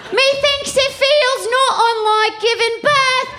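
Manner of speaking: put-on high, slow olde British accent, unbothered at the stake; a Puritan witch in a posh British accent, shrugging off the flames. Comic voicing a burning witch, in a high British accent. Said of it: "that was a British accent".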